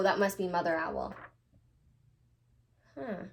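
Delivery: ordinary talk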